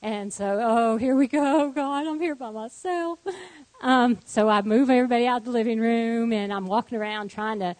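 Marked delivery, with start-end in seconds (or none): 0.6-3.2 drawn-out, higher-pitched weary dismay; mildly hammed-up "here we go" exasperation; exasperated dismay. Mother alone with sick children as another mess hits, exasperated dismay. Acts out her dismayed thought.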